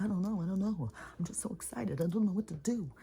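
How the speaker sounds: high, eager, flustered puppy voice; excited dog voice. Retold or imitated. Dog Jack voiced in story.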